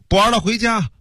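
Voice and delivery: high-pitched call without extra volume. A narrator's reading. Unknown soldier calls across the battle lines, high mock shout. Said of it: "不知道谁喊了一句".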